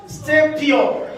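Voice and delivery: usual speaking voice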